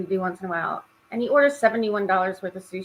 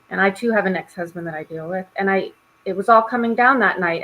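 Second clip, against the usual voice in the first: normal talking voice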